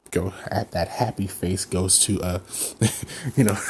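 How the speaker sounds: plain reading voice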